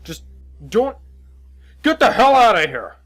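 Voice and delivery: halting start bursting into high shout; exasperated yelling. Acted. Cop orders the witness away, yelling angrily. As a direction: break off, then yell full force.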